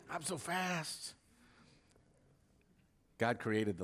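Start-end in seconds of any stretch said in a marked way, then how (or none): 0.1-1.2 mock Ali boast. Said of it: "Muhammad Ali proclaimed".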